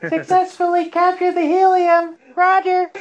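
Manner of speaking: very high, loud squeaky helium voice; high-pitched squeaky voice. Pretend. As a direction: talk like you inhaled helium. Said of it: "really high-pitched squeaky voices".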